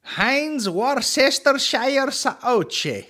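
high, very slow Italian-style imitation; mock Italian accent. Mimicking the man reading the label, mock Italian accent.

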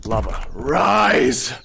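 low, drawn-out command; deep, slow incantation; lava summoner. Pretend. Playing the rising lava in game.

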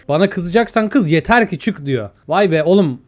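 normal talking voice

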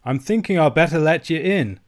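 put-on mildly unsure old uncle; doubtful. A narrator's reading. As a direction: offer it with a touch of doubt. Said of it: "says my uncle doubtfully".

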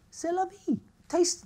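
light flippant French aside; breezy shrug. Real, not acted. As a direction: toss it off like it doesn't matter. Shrugging off sugar.

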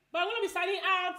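high, loud announcement; excited shout. Real, not acted. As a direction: belt out the sign-off. Same words shouted loudly.

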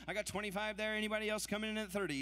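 rapid rhythmic bid chant; auctioneer. Acted. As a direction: rattle off the numbers in a rolling chant. Live charity auction bid calling.

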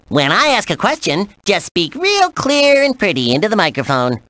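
perky, showy cartoon character; cartoon game host. Host briefs the player on writing a song, cartoon game host. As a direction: present it like a playful cartoon host.

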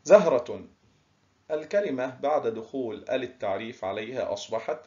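usual speaking voice

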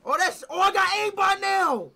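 loud, high, stretched-out celebration; thrilled shout. Real, not acted. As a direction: yell it like you just won. Thrilled by new aiming setting.

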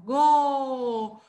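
"Go" loud, with the O held long; teacher's emphatic long "Go"; syllable demo. Shown as a demonstration. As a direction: show the round O by stretching "Go". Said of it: "Olha o O lá na boca".

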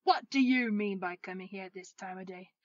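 feigned gruff scolding; mock-stern boss telling off a latecomer; Scrooge. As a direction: growl a loud reprimand in a low voice. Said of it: "growled Scrooge in his accustomed voice".

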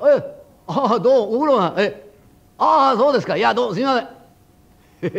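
高く大きめの声でぺこぺこする小僧; 高い声で愛想よく詫びる; 愛嬌のいい小僧. Acted. 小僧が頭を下げて回る. (高い声で愛想よく挨拶する小僧)

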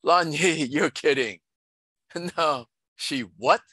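incredulous, rising on the last word; half laughing in disbelief; can't quite believe the news; half-believing. A narrator's reading. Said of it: "answered in half belief".

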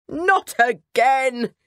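a king fed up with the rats; groaning complaint, stretched out; exasperated cry. Acted. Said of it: "cried the king".

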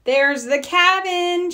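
high, slow, drawn-out happy call from a cub; excited shout. A narrator's reading. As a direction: call out the first sight of the cabin. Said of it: "shouted Sister".

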